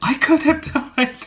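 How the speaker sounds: high, loud, laughing protest; indignant insistence. Retold or imitated. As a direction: demand to be taken seriously, half laughing. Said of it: "But I was just like".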